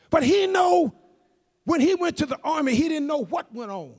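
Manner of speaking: high-pitched, forceful preaching; fervent testimony. Real, not acted. Preacher tells church his cousin found him changed, fervent preaching. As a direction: testify with rising fervour.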